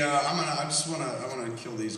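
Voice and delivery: ordinary talk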